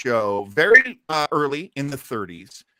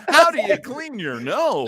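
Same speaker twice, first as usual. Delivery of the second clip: higher, loud mock commercial voice with a laugh; mock ad line; 11 semitones higher, 5 dB louder than usual. Joking about the nose ad, a higher, laughing mock voice. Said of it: "You brush your teeth".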